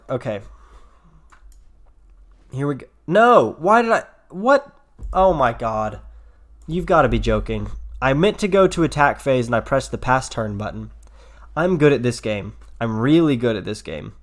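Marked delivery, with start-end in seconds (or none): 3.1-4.8 loud, high real disbelief; shocked outburst. Real, not acted. Misclicked pass turn button. 5.1-10.9 frustrated. Real, not acted. Misclicked pass turn button. 11.6-14.1 sarcastic. Real, not acted. Mocking self after misclick.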